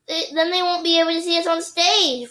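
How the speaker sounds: high, pleading objection; protesting. A narrator's reading. Girl arguing with teacher, high protest. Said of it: "Phoebe protested".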